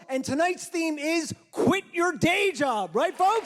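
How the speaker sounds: slow, loud, high hype announcing; hyped host. Acted. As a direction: pump up the crowd like a show host. Hosting, hyping the crowd.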